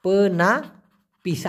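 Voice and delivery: পেনাপিস said very slowly, syllable by syllable; slow pronunciation. Shown as a demonstration. Teacher spelling out a Malay word, slow and clear. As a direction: draw out পেনাপিস clearly for learners. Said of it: "এটাকে কি বলা হবে?".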